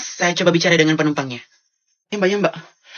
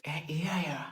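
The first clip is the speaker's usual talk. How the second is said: whisper; 3 semitones lower, 12 dB quieter than usual. Acted. Whispered reply in class.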